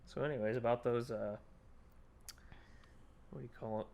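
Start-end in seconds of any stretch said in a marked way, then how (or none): 3.3-3.9 breathy ASMR whisper. Pretend. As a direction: whisper it into the mic. Mock ASMR while eating.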